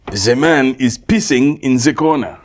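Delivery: hammed-up fake French accent, slow and drawn; awful French accent. Friend imitating the sitcom spy, awful French accent. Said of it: "an awful French accent".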